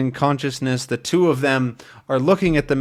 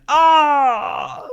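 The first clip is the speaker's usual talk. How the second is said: sharp high shout of pain; high cry when shot; 4 dB louder than usual. Acted. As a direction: yelp as the bullets hit. Man shot in story.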